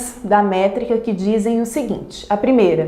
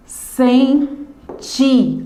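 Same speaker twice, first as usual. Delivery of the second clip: "Sem", pause, "ti", both raised and set apart; syllable counting; 4 semitones higher than usual. Shown as a demonstration. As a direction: count out "Sem ti" as two strong beats. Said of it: "é o ti".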